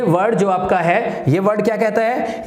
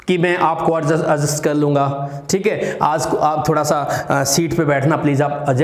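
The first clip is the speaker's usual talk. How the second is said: normal talking voice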